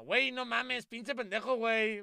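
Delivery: put-on Spanish youtuber faking a Mexican accent; playful mock Mexican accent for a meme. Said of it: "imitando el acento mexicano".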